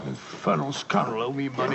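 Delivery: low, quiet scornful mutter; contemptuous muttering. Acted. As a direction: sneer at the keeper under your breath. Watson mutters mocking the proprietor.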